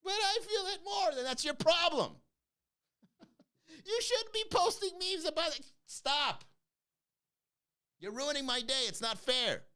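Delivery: high, whiny mock complainer voice; hammed-up whiny victim. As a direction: whine like an oversensitive commenter. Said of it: "It's like".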